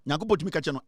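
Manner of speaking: ordinary delivery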